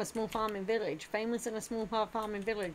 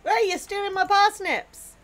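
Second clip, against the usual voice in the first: indignant shout; 10 semitones higher, 10 dB louder than usual. Real, not acted. Catching partner stealing parsnips.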